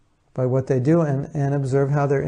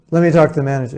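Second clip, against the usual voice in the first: louder, irate customer demand; 2 semitones higher, 7 dB louder, much faster than usual. Acted. Irate customer demanding the manager from the cashier, loud and insistent. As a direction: demand it forcefully, as an annoyed customer. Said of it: "he's getting all irate".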